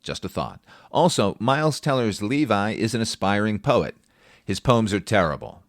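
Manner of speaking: usual speaking voice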